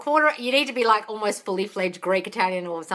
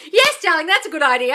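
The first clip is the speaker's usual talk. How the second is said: loud, high burst of praise; enthusiastic approval; 6 semitones higher, 7 dB louder than usual. Real, not acted. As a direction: cheer a client's idea on. Applauds a viewer's manifesting trick.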